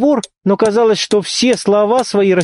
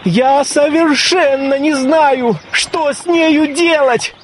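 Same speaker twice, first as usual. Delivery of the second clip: panicked pleading; 9 semitones higher than usual. Acted. Ivan panics about marriage.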